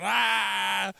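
long, high howl mimicking laughter; mock laughing shriek; imitated cracking up. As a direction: wail like someone helpless with laughter. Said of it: "He was cracking up".

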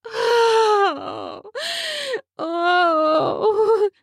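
high, crying moans of pleasure; whimpering cry. Acted. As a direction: let out sharp whimpering cries. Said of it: "I whimper a soft wail".

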